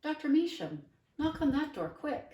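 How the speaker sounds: put-on girl urging the boy to knock quickly; urgent call. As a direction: hurry him to the door.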